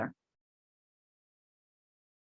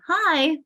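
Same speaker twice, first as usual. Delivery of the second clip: "Hi" pitched much higher, a bright rising greeting; 7 semitones higher, 4 dB louder than usual. Shown as a demonstration. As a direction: sing out "Hi" high and lifted, like a happy hello. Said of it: "could you say".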